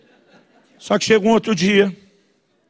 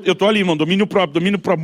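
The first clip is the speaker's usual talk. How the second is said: ordinary talk, nothing marked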